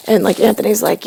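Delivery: normal talking voice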